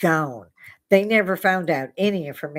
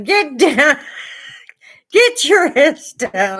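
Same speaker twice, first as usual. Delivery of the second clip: high, loud frantic shout; yelling; 10 semitones higher, 8 dB louder, slower than usual. Retold or imitated. Officers yelling at the floating woman, frantic shout. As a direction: bark the order in a panic, slowly on the last. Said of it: "they're yelling".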